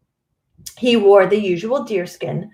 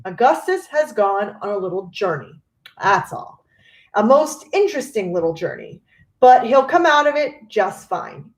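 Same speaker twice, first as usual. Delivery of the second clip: usual speaking voice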